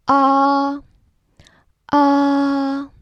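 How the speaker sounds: high flat held ah; first tone demo. Shown as a demonstration. Teacher demonstrating the first tone, high and flat. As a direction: sing Ah out high and level like at the dentist. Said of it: "like a dentist saying".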